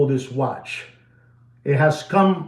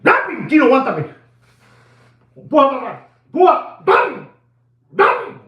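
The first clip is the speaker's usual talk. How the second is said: excited cheering; 10 semitones higher than usual. Real, not acted. Host cheering Gino on to keep going, excited high shouts.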